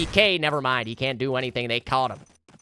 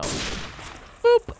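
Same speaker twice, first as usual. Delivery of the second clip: high breathy boop sound; whistled boop. Pretend. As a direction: pop out a playful boop. Sound effect on replay.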